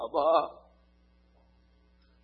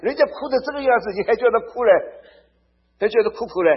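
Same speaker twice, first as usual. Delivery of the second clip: higher, louder, disbelieving exclamation; can you believe it, fast and raised; incredulous; 8 semitones higher, 7 dB louder, faster than usual. Real, not acted. Preacher marvels at the odd comfort, incredulous.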